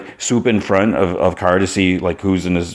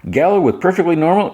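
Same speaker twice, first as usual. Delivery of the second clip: much higher, strained reassuring voice; high nervous Jimmy; 12 semitones higher than usual. Retold or imitated. Jimmy nervously assures Lois the gala is normal, high strained voice. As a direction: play it cool while panicking, pitched way up. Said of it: "he's like".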